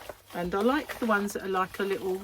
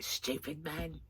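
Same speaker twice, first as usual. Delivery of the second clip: softer muttered comeback; muttered retort; 4 semitones lower, 10 dB quieter, slower than usual. Retold or imitated. Wife muttering back at husband, muttered retort. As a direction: mutter it back under your breath. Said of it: "So I was like".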